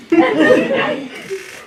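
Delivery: high squealing real laugh. Real, not acted. Laughing at his mom's reaction. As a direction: burst out laughing at the memory.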